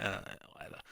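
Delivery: breathless, barely voiced shrug; dismissive mutter. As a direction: throw it away under the breath. Dismissive crowd reaction quoted.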